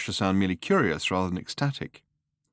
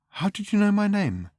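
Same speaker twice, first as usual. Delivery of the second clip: lightly voiced young woman, higher; a higher, curious woman's voice; 12 semitones higher than usual. A narrator's reading. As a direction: narrator lifting into Fenny's voice. Fenny voiced higher than narrator.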